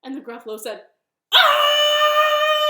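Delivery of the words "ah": long, loud, high held shriek; acted monster's wail of fright; terrified scream. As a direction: scream in storybook panic on one note. Gruffalo screams at bunny's threat.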